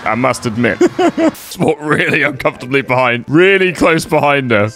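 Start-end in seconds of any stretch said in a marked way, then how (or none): none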